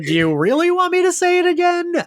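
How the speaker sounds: high, far-off sing-song Drusilla impression; a playful, high imitation of Drusilla; high, mocking Drusilla. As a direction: lilt it up high like a dreamy vampire. Quoting Drusilla, much higher.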